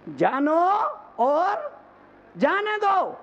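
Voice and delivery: climbing high, with pauses between the parts; rousing motto. Real, not acted. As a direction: break it up and lift it high. Motto repeated forcefully. (रुक-रुककर, सुर चढ़ाते हुए नारा)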